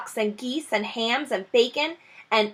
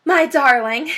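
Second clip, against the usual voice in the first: higher, louder cry of joy; crying out joyfully; 4 semitones higher, 6 dB louder than usual. Acted. Overjoyed wife crying out to Mister Fox. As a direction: Missus Fox throws her arms round her husband. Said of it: "cried Missus Fox".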